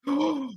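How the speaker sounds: high startled gasp; sharp gasp. Real, not acted. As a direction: gasp in shock at the chance. Fan reacts to close chance.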